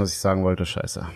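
fading into a soft curse; trailing off. Real, not acted. Hat vergessen, was er sagen wollte. (verliert den Faden und wird leiser)

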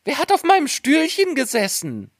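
high, squeaky little dwarf voice; first dwarf. A narrator's reading. First dwarf finds his chair was sat on, high-pitched little voice. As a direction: pipe up as an indignant little dwarf. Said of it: "Der erste sprach".

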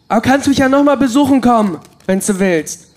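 eager invitation. Acted. Prisoner urging the bird to visit again, eager and high. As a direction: brighten up, beg it to come back.

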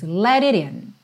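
"let it in" with two flapped T's. Shown as a demonstration. A teacher showing the flaps in "let it in". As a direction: tap both T's in "let it in" lightly. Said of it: "dois T's aí com rarará seguidos".

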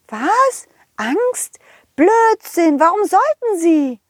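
high fairy voice, indignant. Acted. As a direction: brush it off as a cross little fairy. Fee empört. (empörte Feenstimme)

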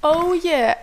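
loud, high "oh yeah"; excited cheer. Real, not acted. Excited about party.

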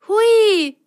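very high, loud whoop; gleeful cry. Acted. Rosenrot whoops in the spinning drum, high and loud. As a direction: squeal with delight. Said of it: "rief Rosenrot".

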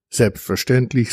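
ordinary delivery

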